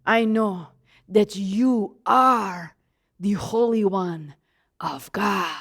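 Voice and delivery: low, slow, dropping voice; demon-possessed man. Acted. Possessed man recognizes the Holy One, low slow menace. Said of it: "there is a demon-possessed man".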